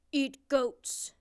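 loud, blunt monster voice. A narrator's reading. Big blue monster answers the princess, loud monster demand. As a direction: demand it like a big hungry beast. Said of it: "said the big blue monster".

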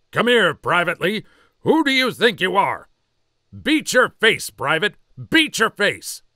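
drill sergeant yell. Acted. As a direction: tear into the private. Drill sergeant scolds private.

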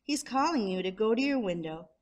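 ordinary delivery, nothing marked

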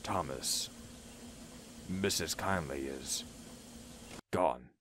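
low, soft, halting bad news; sad. A narrator's reading. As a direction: break it gently, pausing before the end. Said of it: "A sad frown was on his face".

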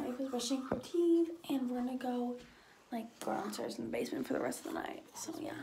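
hushed night whisper. Real, not acted. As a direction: keep it down, whisper it. Late night, quiet in basement.

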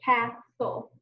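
"Castle" in two beats, cas, tle; slowly tapped-out "Castle": cas ... tle; split into syllables. Shown as a demonstration. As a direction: pause between the syllables of "Castle".

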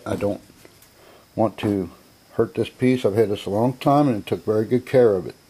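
ordinary delivery

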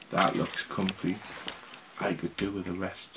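soft, tired murmur; sleepy murmur. Acted. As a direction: sigh it out wearily. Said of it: "said Santa's beard".

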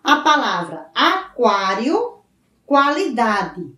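slow "aquário" and "qualidade" with the u clearly sounded as kw; careful pronunciation demo. Shown as a demonstration. Teacher shows pupils the sounded u, slowly. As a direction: stretch each word so the kw is heard.

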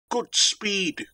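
high-pitched cry of farewell; watchman's cry. A narrator's reading. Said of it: "cried the watch".

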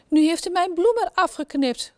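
high, wailing despair; king crying at the servant, high and desperate. A narrator's reading. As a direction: wail it out, heartbroken over the flower. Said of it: "roept hij wanhopig".

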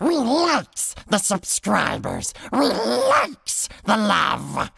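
Gollum arguing with himself. Acted. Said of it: "It'll sound something like this".

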